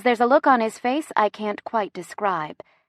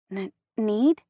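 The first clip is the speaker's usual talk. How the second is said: nervous stutter; 2 semitones higher than usual. A narrator's reading. Woman nervous at his offer, stuttering. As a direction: stammer on the first sound, rising. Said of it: "I'm stuttering".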